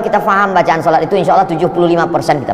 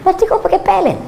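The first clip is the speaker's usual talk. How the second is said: mock mother; 11 semitones higher than usual. Mother questions her son.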